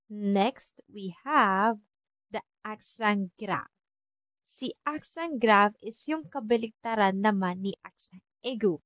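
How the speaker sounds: usual speaking voice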